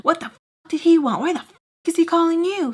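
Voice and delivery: high, fast angry mom voice; mock furious mom. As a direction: imitate mom's fast, angry interrogation.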